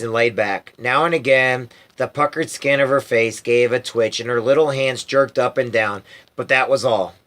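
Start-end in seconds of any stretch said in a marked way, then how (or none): none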